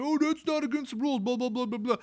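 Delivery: lightly voiced dismissive brat; mocking the kid. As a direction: sneer it like a smug teenager. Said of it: "He's like".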